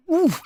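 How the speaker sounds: short high-pitched oof; sudden hurt exclamation; pained oof. A narrator's reading. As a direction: grunt as if your toe is trodden on. Trampled guest's cry of pain.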